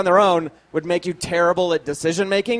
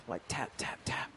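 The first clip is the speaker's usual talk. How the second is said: tapping whisper; 7 semitones lower, 19 dB quieter than usual. Retold or imitated. Mimicking taps in the dark.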